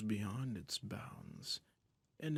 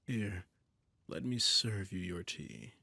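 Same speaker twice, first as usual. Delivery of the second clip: doting emperor. Acted. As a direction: purr control over a frightened pet. Holds a doting, domineering emperor role.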